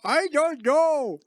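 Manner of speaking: high, slow mock despair; hammed-up wail; dramatic vampire. Vampire bit continues.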